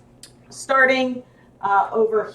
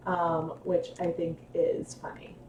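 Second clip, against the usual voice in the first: whisper; 5 semitones lower, 13 dB quieter than usual. Real, not acted. Quiet aside after talk.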